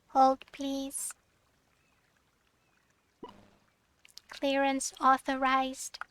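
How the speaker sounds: slow flat robot voice. Acted. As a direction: sound like a machine. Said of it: "read it in a robot voice".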